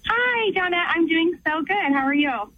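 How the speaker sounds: bright, high-pitched cheery greeting. Real, not acted. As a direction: greet the host cheerfully, pitch lifted then easing down. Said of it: "I just love your cheery voice".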